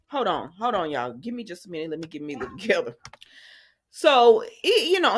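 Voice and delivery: ordinary talk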